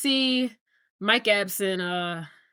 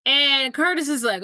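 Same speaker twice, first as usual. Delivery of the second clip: ordinary talk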